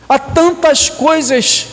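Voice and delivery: ordinary talk, nothing marked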